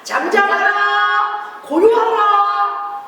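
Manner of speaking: loud, slow drawn-out command; slow commanding shout. Shown as a demonstration. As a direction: call it out for the children to copy. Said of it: "우리도 한번 외쳐봅니다".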